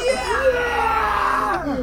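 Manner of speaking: high, long shouted cheer; crowd cheer. Retold or imitated. GM imitates the arena crowd cheering, long and high. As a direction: hold the cheer like a stadium.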